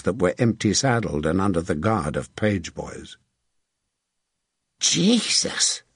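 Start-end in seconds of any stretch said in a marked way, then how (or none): 4.8-5.8 higher-pitched cry of disgust; disgusted exclamation. A narrator's reading. Archer reacting to gore splattered on his bow, disgusted. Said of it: "Tom Scarlett said in disgust".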